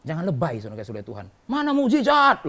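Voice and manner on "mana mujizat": high, loud challenge in a complainer's voice; loud mock protest. Doubter demanding a miracle from the preacher, loud mock protest. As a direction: cry it out like an indignant doubter.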